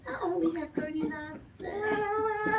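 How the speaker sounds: a wistful, sad sigh of a line; a child sadly counting coins. A narrator's reading. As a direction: let the disappointment show lightly. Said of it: "she said sadly".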